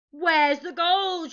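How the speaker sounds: loud, high, drawn-out pirate shout; pirate chief shouting for an answer; demanding shout. A narrator's reading. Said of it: "shouted Hank Chief".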